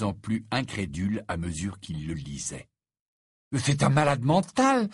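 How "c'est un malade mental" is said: loud, high outburst of outrage; furious. A narrator's reading. Ron reacting to Hagrid's letter, furious exclamation. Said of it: "d'un ton furieux".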